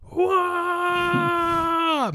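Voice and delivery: high wailing cry of pain; power up scream. Retold or imitated. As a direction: howl as the bench crushes a foot. Marble bench fell on foot.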